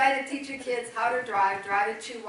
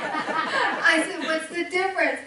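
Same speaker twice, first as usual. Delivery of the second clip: higher, loud question through laughter; laughing retelling; 7 semitones higher, 7 dB louder, slower than usual. Retold or imitated. Mother retelling her challenge to her son, laughing. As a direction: laugh while quoting your own exasperated question.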